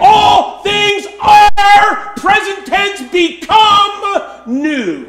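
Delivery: loud, high, shouted sermon; impassioned pulpit shout; full-force preaching. Real, not acted. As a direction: belt it to the back pew, stretching key words.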